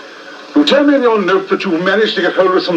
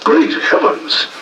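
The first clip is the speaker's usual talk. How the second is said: high, breathless shock; shocked gasp; 11 semitones higher than usual. Acted. Milverton recognising the woman, aghast. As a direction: recoil at the sight of her.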